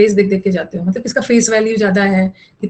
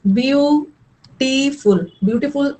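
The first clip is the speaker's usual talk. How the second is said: Beautiful very slow, split as byu-tee-ful; syllable by syllable; 3 semitones higher, much slower than usual. Shown as a demonstration. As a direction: stretch out each syllable of beautiful, pausing between. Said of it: "दो, तीन syllable का बना है".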